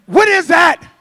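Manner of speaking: loud call across the room. Real, not acted. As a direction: shout the question over the crowd. Calling across noisy room.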